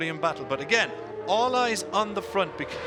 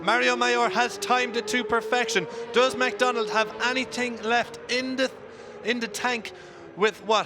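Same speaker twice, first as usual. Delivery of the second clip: motorsport commentator; 5 semitones higher than usual. Real, not acted. Live race call, final lap.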